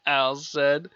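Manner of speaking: plain reading voice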